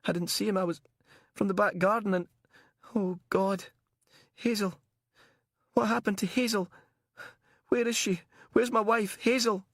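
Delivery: high, frantic and tearful; tearful distress. Acted. Captive husband asking after his missing wife, tearful and frantic. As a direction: build from tearful confusion to high-pitched desperate calls. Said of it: "He started to cry".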